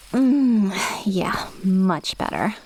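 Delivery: slow, contented whisper; whispered savouring. Acted. Witch enjoys the night air outdoors, slow contented whisper. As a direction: breathe it out as you savour the air.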